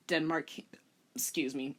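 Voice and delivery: usual speaking voice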